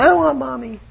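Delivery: put-on high childish whine; little girl voice. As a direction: mimic a small daughter.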